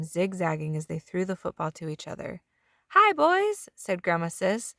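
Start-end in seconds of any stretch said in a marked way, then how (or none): 2.9-4.7 high, lightly voiced grandma welcome; grandma welcoming grandsons with cookies; sweet grandma. A narrator's reading. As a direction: greet the boys like a doting grandma.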